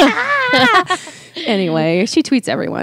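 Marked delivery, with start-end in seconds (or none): 0.0-1.0 high excited shriek; fangirl scream. Retold or imitated. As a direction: shriek like a starstruck fan. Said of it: "I fall down and freak out".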